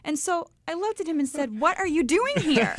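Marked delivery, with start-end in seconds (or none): 1.6-2.7 re-enacted disbelief, high and loud; astonished question. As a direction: demand it in loud, high surprise. Retold surprise at meeting him there.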